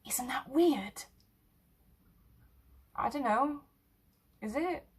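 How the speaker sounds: hushed uneasy whisper. Acted. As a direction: breathe the question, unvoiced. Uneasy about hidden breathing.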